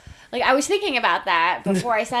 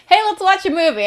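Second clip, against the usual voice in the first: high, louder casual suggestion with a tipsy lilt; lightly acted tipsy; 9 semitones higher, 6 dB louder than usual. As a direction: lightly play a tipsy pitch to someone. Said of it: "be tipsy and be like".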